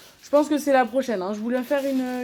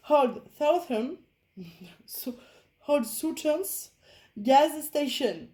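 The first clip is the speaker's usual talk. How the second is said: slow, careful English with a French accent; self-mocking English accent; 3 semitones higher, much slower than usual. Shown as a demonstration. Crafter reads the canvas's English name, playful attempt at English accent. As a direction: try out an English title, drawn out in French-accented English. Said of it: "avec mon super accent, attention".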